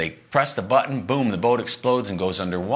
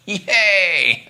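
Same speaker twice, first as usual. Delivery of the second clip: sarcastic yay; 9 semitones higher, 5 dB louder than usual. Retold or imitated. Sarcastic cheer at bad news.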